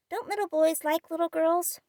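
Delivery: put-on child speaking round a sweet; mouth full. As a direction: talk through a mouthful. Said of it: "with his mouth full and an air of bland satisfaction".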